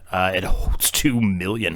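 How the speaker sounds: normal talking voice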